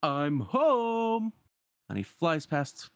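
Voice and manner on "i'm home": high, long-held triumphant call; exuberant announcement. Acted. Genie flying back into town, exuberant call. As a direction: sing out the arrival, stretching the last word.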